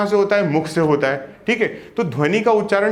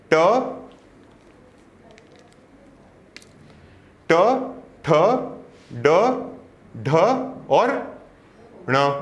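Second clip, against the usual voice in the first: slow letter recitation; slower than usual. Shown as a demonstration. Teacher slowly reciting the ट row to students. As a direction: recite each letter slowly with long pauses. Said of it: "अगर ट की बात करोगे तो क्या आता है आपका?".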